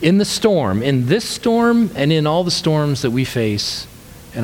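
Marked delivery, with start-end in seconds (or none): none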